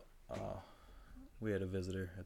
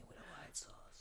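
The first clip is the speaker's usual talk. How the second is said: hushed, put-on accent; tempting whisper; 14 dB quieter than usual. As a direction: whisper it like a hammy food seducer. Food character turns to whisper.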